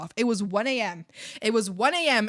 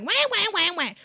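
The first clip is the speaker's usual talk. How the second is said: high, loud mocking wail; exaggerated crybaby wah-wahs; mock whining; 11 semitones higher, 6 dB louder than usual. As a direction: whine it like a big baby. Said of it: "They kept going whining".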